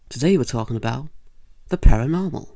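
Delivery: host hyping the paranormal topic loudly; hosty announcement. Acted. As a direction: boom out the spooky topic. Talk show host opener.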